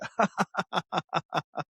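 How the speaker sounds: gleeful cackle; laughing at the hot take. Real, not acted. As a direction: cackle at the provocation. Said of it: "I can cackle".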